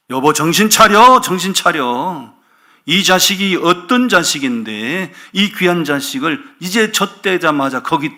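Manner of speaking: loud, high, acted indignation; scolding husband. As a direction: berate your wife as if she has lost her senses. Husband scolding wife in imagined quarrel. (아내를 버럭 나무라는 남편처럼)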